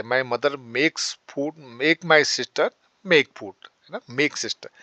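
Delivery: slowly, word by word; slow dictation. Shown as a demonstration. Teacher slowly models the causative sentence for students, deliberate. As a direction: lay each word out slowly. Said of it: "बहन से खाना बनवाती है".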